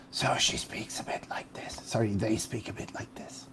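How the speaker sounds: soft, hoarse, breathy mummy whisper. Shown as a demonstration. GM showing how the mummy Pam speaks, hoarse whisper. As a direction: let the voice go dry and airy. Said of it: "more of a hoarse, indefinite voice".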